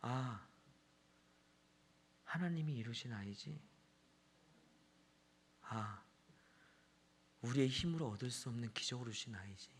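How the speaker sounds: soft, reflective murmur to oneself; hushed realization. Retold or imitated. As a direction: let it dawn on you quietly, with pauses. Dad's hushed realization about his child. (조용히 깨닫는 혼잣말)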